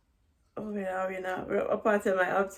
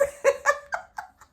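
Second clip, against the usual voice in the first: high laugh; 7 dB louder than usual. Real, not acted. Laughing at own story.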